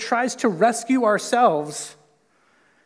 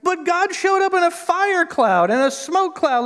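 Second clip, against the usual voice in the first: high, loud, whining complaint; whiny Israelite; 10 semitones higher, 4 dB louder than usual. Retold or imitated. Israelites complaining to God in the desert, high mock whine. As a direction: whine like a spoiled grumbler.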